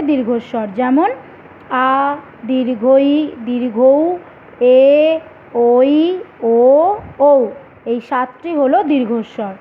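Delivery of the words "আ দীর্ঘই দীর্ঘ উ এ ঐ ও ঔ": each vowel in আ, দীর্ঘই, দীর্ঘ উ, এ, ঐ, ও, ঔ drawn out slowly; long vowels. Shown as a demonstration. Teacher listing examples for students, long held vowels. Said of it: "উচ্চারণে দীর্ঘ সময় লাগে".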